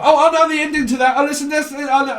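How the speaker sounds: high, loud mock shout of a know-it-all; yelling the answer like a quiz show; over-eager blurt. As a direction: blurt it out before anyone else.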